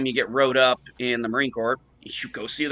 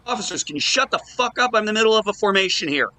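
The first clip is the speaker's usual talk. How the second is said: retold angry yell; 8 semitones higher, faster than usual. Gunny yells at chatting officers.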